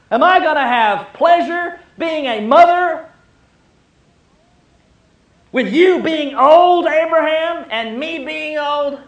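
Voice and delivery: high and loud, in mock disbelief; incredulous Sarah. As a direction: she can't believe what she heard. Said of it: "is as incredulousness".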